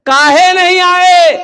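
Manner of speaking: high, loud, drawn-out challenge; shouted demand. Acted. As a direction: stretch the question into an indignant shout. Preacher shouts the retold question. (ज़ोर से खींचकर डाँटता सवाल)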